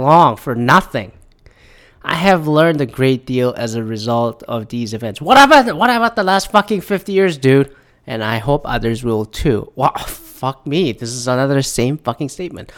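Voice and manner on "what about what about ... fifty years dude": sudden high loud indignant cry; exasperated yell at a bogus apology; outraged outburst. Real, not acted. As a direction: blow up at the statement in disbelief. Mocking apology statement.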